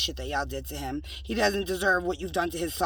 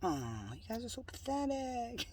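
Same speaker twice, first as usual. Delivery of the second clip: mocking whisper; 3 semitones higher, 13 dB quieter than usual.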